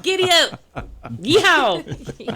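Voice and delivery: high, drawn-out rodeo cheer; mock rodeo holler; playful cowboy whoop. Offers exuberant cowboy cheers for excited.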